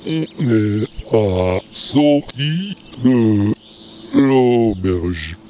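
drawn-out slow speech. Acted. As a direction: stretch every word, very slow. Slow talk continues.

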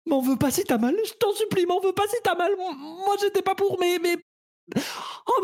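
high, frantic begging; panicky pleading. Acted. About to operate with fork.

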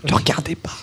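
a quick unvoiced whisper aside. Real, not acted. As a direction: hush it as a playful aside. Aside not to look, whispered. (chuchoté en aparté)

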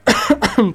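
a sudden sharp cough; coughing fit. Real, not acted. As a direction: cough hard mid-sentence. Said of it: "Pardon, je tousse".

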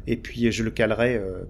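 ordinary talk, nothing marked

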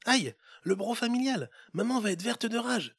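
put-on a child wincing at the broken jug; little boy. Little boy fearing his mother's anger, high and dismayed. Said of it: "souffla le garçon".

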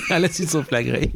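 repeating it through giggles; holding back laughter; giggling. Real, not acted.

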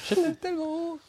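hammed-up high cartoon voice; mock faller. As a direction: squeak it like a tiny falling man. Acting the falling Lego man.

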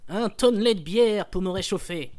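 booming, slow dwarf bellow; a loud, slow burly dwarf. Acted. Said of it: "cher maître nain".

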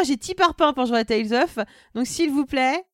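ordinary talk

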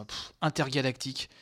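ordinary talk, nothing marked

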